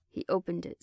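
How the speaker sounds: plain reading voice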